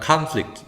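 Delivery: conflict stressed first, "KAHN-flict"; front stress. Shown as a demonstration. Teacher modelling the noun form, conflict stressed as KAHN-flict. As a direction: open "ah" on the stressed start of conflict. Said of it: "아로 발음하니까".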